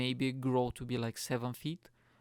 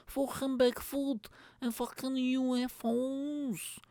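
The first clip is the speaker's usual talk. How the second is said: much higher, hammed-up Scouse voice; exasperated high-pitched mock Scouse rant; Scouse accent; 12 semitones higher than usual. Podcaster ranting at James for texting him, not very good Scouse accent. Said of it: "This is a Scouse accent".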